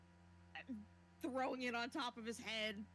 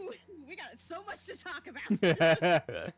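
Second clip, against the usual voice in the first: teasing; 14 dB louder, slower than usual. Real, not acted. Teasing about the mission.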